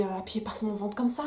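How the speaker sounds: ordinary delivery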